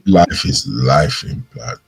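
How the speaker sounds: low, slow, heavy-hearted; pained sigh. Retold or imitated. Imitating the pained way people say it, low and slow. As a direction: let the pain drag it down. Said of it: "you could hear the pain".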